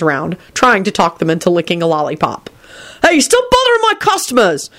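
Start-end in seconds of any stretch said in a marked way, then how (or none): 3.0-4.7 high mock shout at a customer. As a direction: bark it up high to shoo him off. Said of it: "shouted George".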